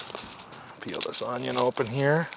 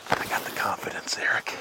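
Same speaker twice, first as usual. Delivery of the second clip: hushed whisper to Eric; breathy hushed confidence; 6 dB quieter than usual. Real, not acted. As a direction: whisper so the fish don't hear. Quiet aside while fishing.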